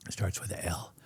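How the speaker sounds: whispered, like sharing a secret. Real, not acted. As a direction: drop to a hushed whisper to dodge the brand name. Can't name the brand on air.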